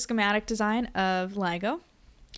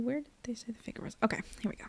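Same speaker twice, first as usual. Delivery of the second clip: whispering; 2 semitones higher, 7 dB quieter, much faster than usual. Real, not acted. Muttering to self finding figure.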